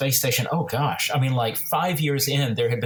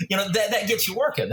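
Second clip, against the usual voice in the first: normal talking voice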